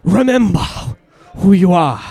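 hammed-up African accent for fun; playful African accent at the congregation. Shown as a demonstration. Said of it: "See if you can get that African accent".

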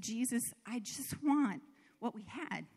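soft, hushed prayer on her knees; quiet plea. Retold or imitated. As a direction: plead softly, close to tears. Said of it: "I just said".

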